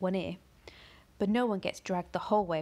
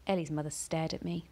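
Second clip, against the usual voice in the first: ordinary delivery, nothing marked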